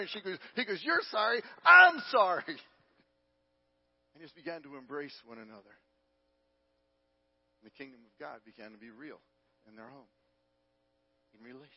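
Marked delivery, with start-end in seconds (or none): 0.8-2.4 "I'm" leaps up high and loud; acted emphatic apology. Husband answering his weeping wife, stress thrown onto "I'm". 2.5-11.7 hushed sermon storytelling. A narrator's reading. Preacher closing the reconciliation story, hushed.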